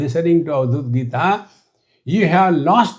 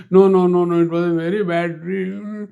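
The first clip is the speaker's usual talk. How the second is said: a guru stubbornly refusing comfort; insistent; 4 semitones higher than usual. Acted. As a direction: insist it was a very bad dream. Guru insists on his bad dream.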